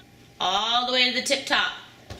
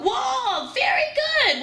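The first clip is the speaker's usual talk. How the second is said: excited praise. Real, not acted. Mother praising child's right answer.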